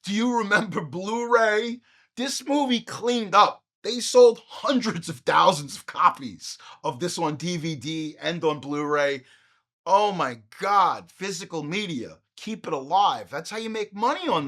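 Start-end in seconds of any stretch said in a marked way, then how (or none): none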